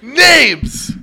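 loud, high demand for names; demanding shout. Acted. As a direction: bark out the demand like an interrogator. Mock interrogation demanding names.

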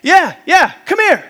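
insistent parrot summons; screaming parrot. Acted. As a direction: call him over as a pushy parrot. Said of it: "this parrot is screaming in the background".

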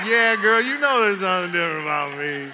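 loud playful sass; pastor's cheeky reply, loud and laughing; sassy reply. Retold or imitated. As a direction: tease her back with swagger. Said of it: "I said".

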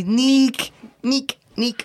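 "Neek" high-pitched with a long ee; Italian pronunciation imitation. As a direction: say "Neek" as Italians would, vowel stretched. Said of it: "they say my name so weird".

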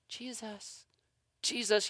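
soft, hushed calling of Jesus; gently nudging a sleeping friend awake; gentle nudge. Shown as a demonstration. Disciples softly waking Jesus, hushed and gentle. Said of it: "They were not calm".

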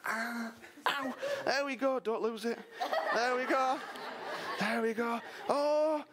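fussing happily over the returned son; excited father. Acted. Father dressing his son, celebrating.

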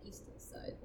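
breathy whispered correction; a quiet whispered aside. Real, not acted. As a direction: slip it in under the breath. Correcting himself under the breath.

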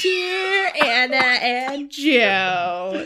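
very drawn-out pretend letter reader; mock complaint letter. Co-host voicing a Jersey listener's letter, slow mock complaint. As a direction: stretch every word as a pretend offended listener.

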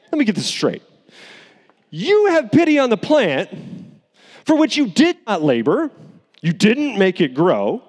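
lightly mocking, let me get this straight; sassy God. God sassily confronting upset Jonah over the plant, sarcastic. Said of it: "He says, he says basically".

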